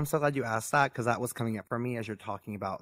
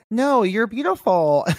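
mock reassurance; 10 semitones higher, 10 dB louder, slower than usual. Retold people correcting her.

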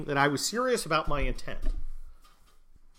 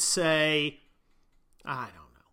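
usual speaking voice

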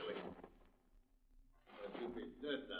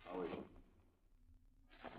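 dying breath; 6 semitones lower, 6 dB quieter, much slower than usual. Acted.

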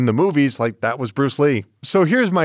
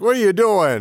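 put-on old man voice; 5 semitones higher than usual. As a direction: grumble like a cranky old man. Old guy voiced in retelling.

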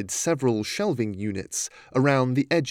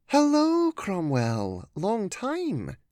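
high, swooping, soft lady's greeting; soft-voiced woman; 8 semitones higher, much slower than usual. A narrator's reading. Woman greets old friend from doorway, soft-voiced. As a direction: float a high hello, then let it fall away softly. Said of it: "the soft sound of a muted woman's voice".

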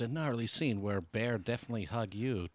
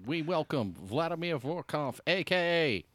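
announcer voice; 6 semitones higher than usual. Acted. Mock show announcement.

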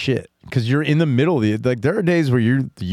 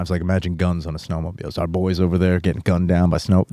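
usual speaking voice

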